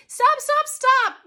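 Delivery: high, loud, urgent stop; desperate high-pitched yelling; screamed plea. A narrator's reading. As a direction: scream it to halt the chaos. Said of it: "Abby screamed".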